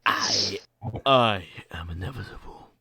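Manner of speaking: deep, measured supervillain; Thanos. Acted. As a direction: play a titan stating his doom quietly. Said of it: "does a great Thanos impression".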